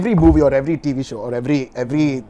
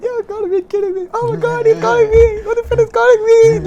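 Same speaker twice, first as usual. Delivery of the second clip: much higher, breathless and squealing; retelling his own freakout over the idol's call; giddy disbelief; 7 dB louder than usual. Retold or imitated. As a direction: lose it like a starstruck fan.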